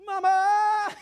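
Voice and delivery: loud mock call; imitated shout. Retelling a family of shouters, loud mock call. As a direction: yell it like a family member calling out. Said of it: "Alguien grita".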